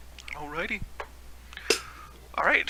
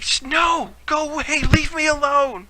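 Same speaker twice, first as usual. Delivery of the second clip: high-pitched, raised-voice yell; frightened-off yell; 10 semitones higher, 4 dB louder than usual. Acted. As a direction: yell at them to back off. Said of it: "he yells at you to go away".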